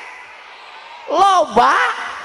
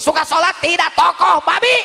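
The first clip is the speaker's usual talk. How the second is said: raised, scornful punchline; defiant retort; 4 semitones higher than usual. Acted. Preacher scoffs at the community leader, higher defiant voice. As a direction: climb in pitch to dismiss the big shot.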